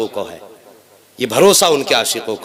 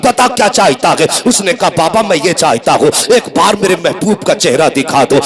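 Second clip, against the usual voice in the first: driving, emphatic sermon storytelling; fervent Urdu preacher's delivery; impassioned preaching; 2 semitones higher, 4 dB louder than usual. A narrator's reading. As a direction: preach the tale in urgent, unbroken waves. Preaches a story at full force.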